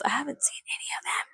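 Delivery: whispered confession; confessing whisper. Real, not acted. Vlogger confessing to viewers she hasn't seen the films, whispered. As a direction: lean in and whisper the secret. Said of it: "can I confess something to you".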